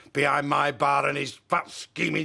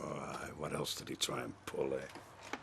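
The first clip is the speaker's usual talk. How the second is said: much lower, soft, insinuating; suspicious murmur; 17 dB quieter than usual. Acted. Jealous husband questioning his wife about Alec, low suspicious murmur. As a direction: let the suspicion seep out quietly.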